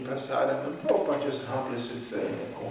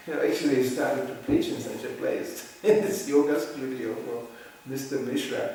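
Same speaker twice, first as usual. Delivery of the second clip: ordinary talk, nothing marked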